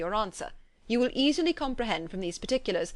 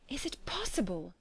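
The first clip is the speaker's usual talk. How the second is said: softer, hushed disbelief; stunned disbelief; 2 semitones higher, 6 dB quieter than usual. A narrator's reading. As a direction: she lowers the letter, astonished. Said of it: "cried Elizabeth when she had finished".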